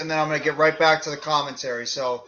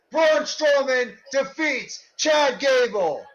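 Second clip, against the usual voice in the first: wrestling commentator; 8 semitones higher than usual. Acted. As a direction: shout the big moves like live TV. Live play-by-play of match.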